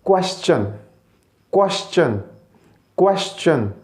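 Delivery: "क्वेश्चन" as kwes-chun, falling each time; correct pronunciation. Shown as a demonstration. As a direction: drill "क्वेश्चन" clearly, a pause after each. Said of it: "ये क्या है जी?".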